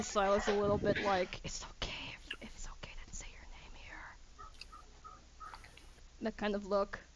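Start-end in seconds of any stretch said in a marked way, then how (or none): 1.4-4.0 breathless whisper; whispered prompt. Retold or imitated. Darius silently prompts Silas to introduce himself, whispered. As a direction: urge them quietly, just between you two. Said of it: "Darius looks to you... like".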